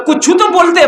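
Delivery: high-pitched acted scolding; mock-exasperated telling-off; exasperated mother. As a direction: plead crossly with a tongue-tied son. Mother scolds son for silence.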